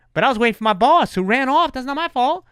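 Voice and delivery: high-pitched mock self-defence; retold whine of protest; indignant protest. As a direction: plead innocence, pitch shooting up. Protesting to the angry firefighter.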